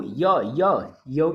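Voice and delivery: य repeated alone as a drawn-out "ya"; sound demo. Shown as a demonstration. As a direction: isolate the y-glide, य by य. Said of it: "य का sound जब आएगा यू से". (य की ध्वनि अलग-अलग दोहराकर)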